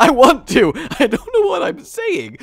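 high, loud mock-desperate wail; sudden outburst. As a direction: burst out in comic exasperation. Suddenly bursts out after subdued aside.

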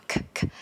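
voiceless puffed "K"; aspirated K. Shown as a demonstration. Teacher isolating the consonant for learners, breathy aspirated "K". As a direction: say "K" as just a burst of air. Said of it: "as in the air coming out".

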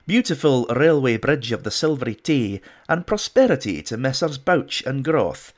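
loud, hammed-up Scottish accent reading a bad poem. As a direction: declaim it in a mock Scottish brogue. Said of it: "in my best Scottish accent".

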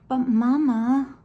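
plain reading voice

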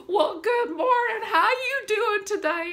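very high, loud, drawn-out greeting; imitated bubbly shop worker; full of energy. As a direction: gush a big good morning. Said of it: "he's just full of energy".

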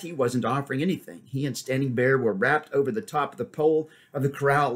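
usual speaking voice

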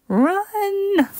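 high whispered shout of alarm; panicked cry. Acted. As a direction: warn them to flee. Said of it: "honked Goose".

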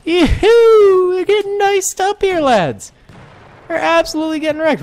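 high, loud battle excitement; gleeful whooping. Real, not acted. Gunner to his lads as enemies fall, gleeful.

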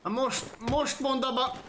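high, worked-up challenge; heated taunt. Real, not acted. Player goading a game opponent in a fight, heated taunt.

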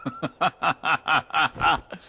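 short mocking villain chuckle; mocking laugh. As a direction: laugh him off with scorn.

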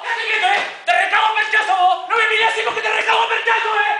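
loud, high cop threats; pretend cop shouting. Kid playing cop yells at the robber. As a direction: yell like a tough cop arresting a thief.

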